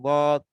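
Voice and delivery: "গদ" with the tongue's edge against the upper molars; tongue-edge articulation. Shown as a demonstration. Teacher showing where the tongue touches, tongue-edge articulation. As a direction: press the tongue's side to the molar roots for "গদ". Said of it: "গোড়ার সাথে লাগিয়ে দেব".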